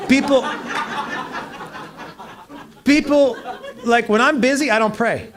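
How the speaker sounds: laughing joke that he doesn't pray to be left alone; laughing loud joke. Real, not acted. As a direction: ham up the joke while laughing. Laughing joke, then kidding.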